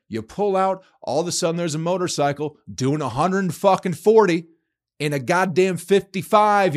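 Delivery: heated shout about speeding bikers; angry rant. Real, not acted. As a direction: rail at the reckless riders, voice climbing. Ranting about reckless motorcyclists.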